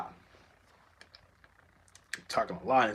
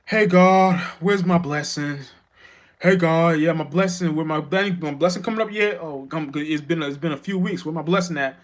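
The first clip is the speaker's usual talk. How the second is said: higher, louder mock beggar calling out to God; whiny entitled churchgoer; 4 semitones higher, 5 dB louder than usual. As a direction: ham up a pushy churchgoer demanding his blessing.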